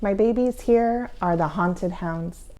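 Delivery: usual speaking voice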